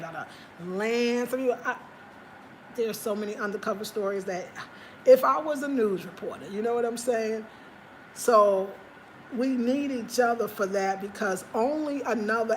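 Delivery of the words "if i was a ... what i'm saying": usual speaking voice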